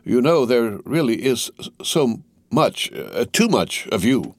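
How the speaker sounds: hesitant, faltering over the words; mildly halting, reluctant aside; reluctant admission. A narrator's reading. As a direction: let it out as if against your will. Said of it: "as if he were compelled against his will".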